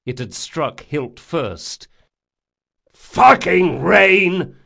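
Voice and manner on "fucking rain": roared out, slow and enraged; furious yell. A narrator's reading. Jean cursing the rain, furious yell. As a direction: bellow a curse at the rain. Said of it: "yelled Jean".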